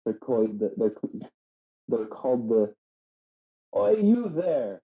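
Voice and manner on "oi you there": high, slow mock Cockney "Oi, you there!"; horrible British accent. Shown as a demonstration. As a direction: bark it out in an awful British accent. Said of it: "you have to say it with a horrible accent".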